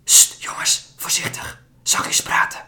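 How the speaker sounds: hushed, fearful warning whisper; a woman whispering a frightened warning; anxious whisper. Acted. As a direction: shush them, afraid of being heard. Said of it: "waarschuwt een van de vrouwen angstig".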